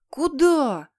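loud, high indignant shout. Acted. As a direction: yell it out in protest. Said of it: "заорал послушный Питер". (громкий возмущённый крик)

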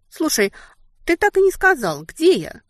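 high, quick sudden realization; suddenly remembering. Acted. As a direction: blurt it out as it occurs to you. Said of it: "спохватилась я".

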